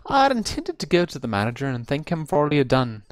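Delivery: plain reading voice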